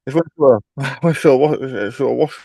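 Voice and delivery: laughing through the words; can't stop giggling mid-sentence. Real, not acted. Laughing while telling funny story.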